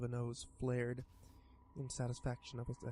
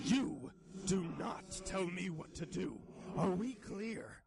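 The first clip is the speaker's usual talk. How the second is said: slow, high menacing monster; menacing scowl; 6 semitones higher, slower than usual. Acted. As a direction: threaten him coldly and slowly. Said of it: "replaced by a scowl".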